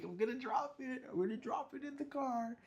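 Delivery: put-on high, playful taunting kid voice; singsong schoolyard tease; childish teasing. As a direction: tease like a silly kid keeping his shake. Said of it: "And I'm like".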